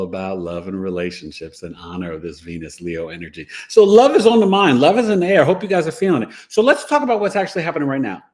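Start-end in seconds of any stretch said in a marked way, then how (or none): 3.7-8.2 bright, louder, higher opener; excited greeting. Real, not acted. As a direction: pump up the live audience. Upbeat opening of live show.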